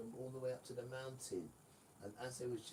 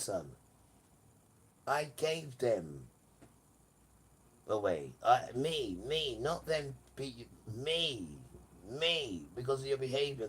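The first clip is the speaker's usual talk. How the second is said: louder, insistent preaching; Father's forceful preaching; 3 semitones higher, 7 dB louder than usual. Retold or imitated. Father telling the son about slavery, loud and insistent. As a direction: hammer each me like a stern father.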